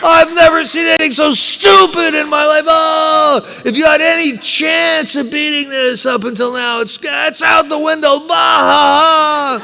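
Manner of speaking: high, loud, mocking lawyer roaring with laughter; howling lawyer. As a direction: play the lawyer cracking up and shouting at the client. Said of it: "just starts howling".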